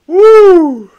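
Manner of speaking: very high, loud woo; victory woo. Real, not acted. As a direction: whoop with joy. Celebrates after victory.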